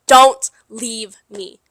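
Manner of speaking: slow, loud, each word weighted; a firm, drawn-out plea; emphatic demand. Retold or imitated. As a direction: lay down the condition slowly and loudly. Pleading terms set with boyfriend.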